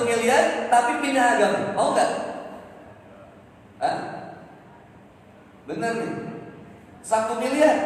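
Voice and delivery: preaching. Acted. Preacher exhorting the congregation on lost property, full force. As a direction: preach it out to the crowd. Preacher addresses mothers and women.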